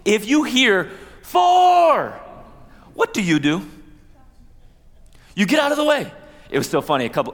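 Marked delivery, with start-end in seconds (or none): 1.2-2.2 high, loud shout of "Four"; a full-voiced warning shout; golf warning call. Shown as a demonstration. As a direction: yell it across the fairway to warn golfers. Golfer's warning call demonstrated.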